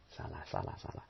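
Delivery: wife quietly repeating salah; murmured repetition. Acted. Wife repeating her fault softly.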